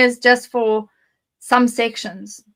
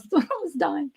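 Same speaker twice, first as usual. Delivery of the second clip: laughing; 5 semitones higher, 6 dB quieter than usual. Real, not acted. Laughs recalling childhood fright.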